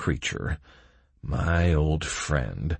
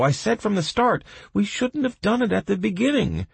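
high, heated squabbling; bickering prisoners; 12 semitones higher, 4 dB louder than usual. Acted. Prisoners blaming each other for the sentence, bickering. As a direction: trade accusations in raised voices.

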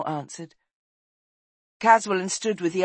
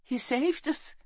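high-pitched youngster; light, high young voice; young boy's voice; 10 semitones higher than usual. A narrator's reading. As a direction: pitch it up like a boy. Boy character speaks to dying queen.

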